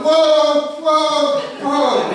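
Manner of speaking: loud, high whoa to the horse; calling whoa. Retold or imitated. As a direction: rein in a horse, loudly. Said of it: "I'm going".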